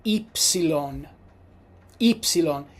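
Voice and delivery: "Ypsilon" as EEP-see-lon; "Ypsilon" with an ee onset; Italian ypsilon. Shown as a demonstration. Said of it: "the letter Y in Italian is pronounced".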